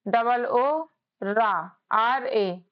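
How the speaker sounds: spelled out very slowly; slow spelling. Shown as a demonstration. Teacher spelling slowly for students. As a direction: draw each letter out slowly for the class. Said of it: "भा का बी एच होगा".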